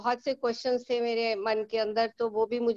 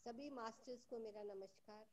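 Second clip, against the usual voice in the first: faint, distant voice over a weak mic; very faint, like far from the mic; barely audible; 3 semitones lower, 25 dB quieter than usual. Real, not acted. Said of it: "[name] जी, आपकी आवाज कम आ रही है".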